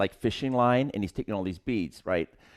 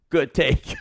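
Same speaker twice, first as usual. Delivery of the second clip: laughing imitation; 6 semitones higher, 3 dB louder than usual. Director's praise retold with a laugh.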